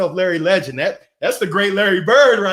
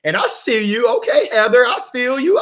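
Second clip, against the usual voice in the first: normal talking voice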